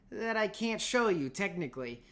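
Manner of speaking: usual speaking voice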